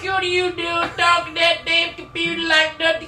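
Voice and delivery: put-on high, ranting old grandpa; hammed-up, high-pitched grandpa grumbling; crazy grandpa. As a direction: rant like a crazy country grandpa. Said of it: "You're like Grandpa".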